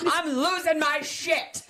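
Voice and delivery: high, slow, drawn-out mock rage; mock meltdown. Mom on the drive home, mock meltdown. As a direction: exaggerate every word like an unravelling parent. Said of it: "if I am this aggressive".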